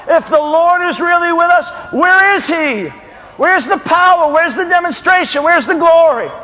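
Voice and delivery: high, impassioned revival preaching; full-force preaching. Real, not acted. Preacher challenging the church about revival, impassioned and high. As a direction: drive it home, building to a shouted climax.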